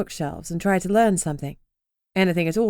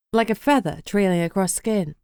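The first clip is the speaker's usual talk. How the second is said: plain reading voice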